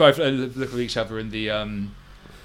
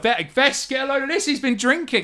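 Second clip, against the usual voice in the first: high, teasing mockery building louder; high mocking voice; 8 semitones higher, 4 dB louder than usual. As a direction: rib him like a smug older kid. GM voices mocking half-elf.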